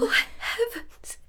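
barely able to say it; stricken whisper. Acted. Young woman whispering in horror on learning she is pregnant.

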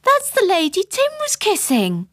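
put-on very high childish announcement; excited little girl telling tales; child's shout. A narrator's reading. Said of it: "I shouted, running to my mother".

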